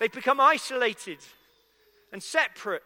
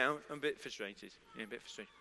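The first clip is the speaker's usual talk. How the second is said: low and much softer, mumbled down into the chest; a low, soft grumble of a mild complaint; muttered understatement; 12 semitones lower, 12 dB quieter, faster than usual. Shown as a demonstration. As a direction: play it down, barely audible and low. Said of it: "How are you today?".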